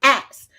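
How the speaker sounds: "ass" said in place of "ask", no K; K dropped. Shown as a demonstration. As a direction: punch "ass" as the misheard "ask". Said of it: "they say it sound like I'm saying".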